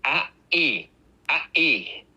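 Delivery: "A E" with the throat catching the air; glottal stop demo. Shown as a demonstration. Teacher showing how a glottal stop sounds. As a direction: clamp the throat shut before the E. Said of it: "your throat stops the air".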